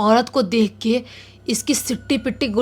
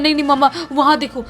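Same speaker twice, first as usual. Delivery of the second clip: higher, fast frightened plea; scared insistence; 5 semitones higher, 4 dB louder, faster than usual. Acted. Boy pointing the uncle at the tree, frightened. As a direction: urge him to look, panicking.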